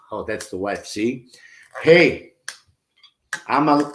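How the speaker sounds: ordinary talk, nothing marked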